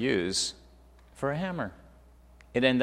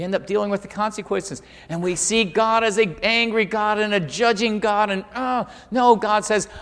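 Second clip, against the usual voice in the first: raised, louder preacher's voice; forceful preaching; 9 semitones higher, 9 dB louder than usual. Acted.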